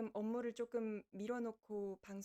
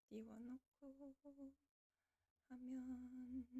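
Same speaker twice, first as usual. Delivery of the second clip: muttering to self; 9 dB quieter, much slower than usual. Real, not acted. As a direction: talk quietly to yourself, absorbed. Muttering while setting up capture. (작업하며 작게 혼잣말로)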